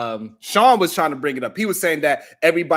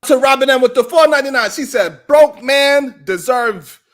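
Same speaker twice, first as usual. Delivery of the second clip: loud announcement; 6 semitones higher, 6 dB louder than usual. Acted. Reading a super chat aloud.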